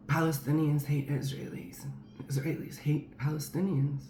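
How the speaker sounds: imitating friend's careless tone; offhand, like whatever. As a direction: toss it off as nothing. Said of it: "Like that, just like whatever".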